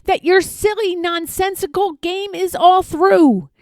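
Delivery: a high-pitched, sharp snap of contempt; snapping. Acted. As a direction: bite off each word like a bossy kangaroo. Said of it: "snapped the big kangaroo".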